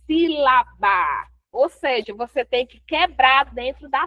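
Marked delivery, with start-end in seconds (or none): 0.1-1.3 sílaba spelled out slowly as sí-la-ba; syllable by syllable. Shown as a demonstration. Teacher completing her own question, sílaba slowly syllabified. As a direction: break sílaba into separate, drawn-out beats. Teacher stressing the key term.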